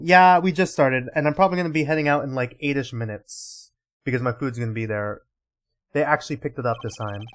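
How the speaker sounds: usual speaking voice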